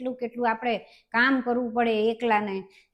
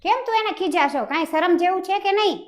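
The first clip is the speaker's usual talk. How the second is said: higher, louder scolding mother; mother's loud indignant scolding; 7 semitones higher, 5 dB louder than usual. Acted. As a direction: scold him sharply, outraged. Mother scolds son in quarrel.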